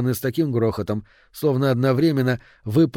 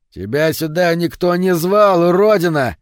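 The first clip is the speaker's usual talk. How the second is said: hostile shout; 6 semitones higher, 6 dB louder than usual. A narrator's reading. Local advancing on the albino biker, hostile shout.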